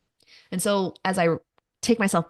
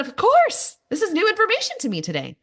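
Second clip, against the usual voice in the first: much higher, louder, fast mock surprise; sarcastic; 13 semitones higher, 5 dB louder than usual. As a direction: fake astonishment, dripping irony. Sarcastic reply to therapist.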